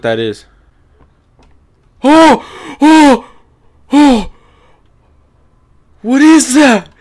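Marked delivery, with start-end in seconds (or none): 2.0-4.3 high loud startled cries; frightened yelps. Real, not acted. Jump scare in horror game. 6.0-6.9 high loud panicked question; frightened. Real, not acted. Monster appears, still startled.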